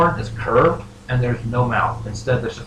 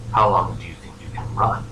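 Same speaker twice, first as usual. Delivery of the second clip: low voice from the unseen; mysterious voice; 5 semitones lower, 3 dB quieter than usual. Acted. Unseen figure questioning the dreamer, low and ominous. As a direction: pitch it down as a lurking presence. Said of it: "a voice promptly proceeds to speak out".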